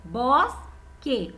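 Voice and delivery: "Bosque" in two separate beats, bos, pause, que; syllable by syllable. Shown as a demonstration. Teacher counting mouth openings for pupils, "Bosque" split in two. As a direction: break "Bosque" after the S. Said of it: "Quantas vezes eu abri a minha boca".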